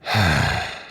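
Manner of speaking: low, breath-heavy sigh; weary exhale; bored sigh. Acted. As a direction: let out a long impatient breath. Waiting, bored.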